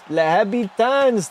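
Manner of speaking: high, drawn-out French pronunciation; French accent. Acted. Streamer cheering Les Habitants, French accent. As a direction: put on a French accent, slow and lifted. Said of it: "Stop with the French accent".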